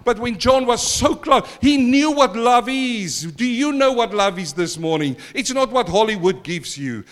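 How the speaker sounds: loud, driving sermon; impassioned preaching. Acted. Preacher to church, loud and driving. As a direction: preach it with force.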